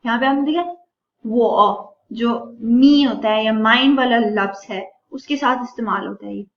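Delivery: ordinary talk, nothing marked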